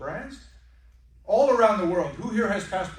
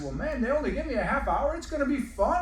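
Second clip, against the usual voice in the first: much higher, bright mock-cheerful; cheery salesman reply; 8 semitones higher than usual. Salesman answers the impatient guest, in a much higher mock-cheerful voice. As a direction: play the overly chipper host. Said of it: "And I said".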